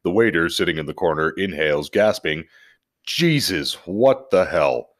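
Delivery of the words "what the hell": ordinary talk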